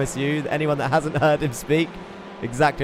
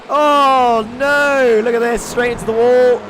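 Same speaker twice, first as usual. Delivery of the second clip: shocked crash reaction; 10 semitones higher, 10 dB louder, slower than usual. Real, not acted. Crash seen on replay.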